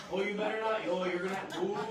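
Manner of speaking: loud, hammed-up warning; Satan's taunting role. Satan to someone afraid to confess, loud mock-ominous taunting. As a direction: wind them up with mock dread. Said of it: "Satan fills that, like, that role of".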